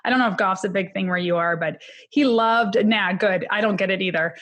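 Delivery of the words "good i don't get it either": ordinary talk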